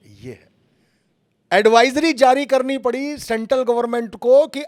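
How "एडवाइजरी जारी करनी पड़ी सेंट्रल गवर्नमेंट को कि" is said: high, very loud shout; raised-voice rant at full volume. Acted. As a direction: belt it out like a screaming news anchor. Criticising news anchors who scream.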